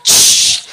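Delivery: loud sustained hiss; imitated frying sizzle. Wife turning the pancake, loud vocal sizzle.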